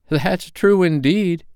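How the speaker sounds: put-on high, slow agreeing old woman; drawn-out prim laundress; laundress's voice. A narrator's reading.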